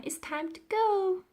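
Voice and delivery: put-on high eager girl voice; Florentine's voice. A narrator's reading. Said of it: "said Florentine".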